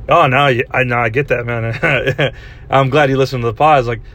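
ordinary delivery

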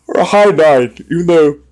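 words stretched through a big yawn. Real, not acted. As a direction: talk while yawning late at night. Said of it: "sorry, I'm yawning".

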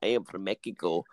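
low-pitched imitation Mexican accent; mocking Mexican accent. Showing how the Spanish make fun of Mexicans, mock Mexican accent. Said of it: "even the Spanish make fun of them".

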